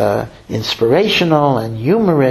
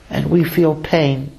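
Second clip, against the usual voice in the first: usual speaking voice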